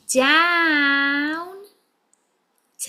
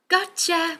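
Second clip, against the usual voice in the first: higher bright gotcha; excited gotcha; 6 semitones higher than usual. Shown as a demonstration. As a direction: catch it playfully. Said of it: "What happens when we find it?".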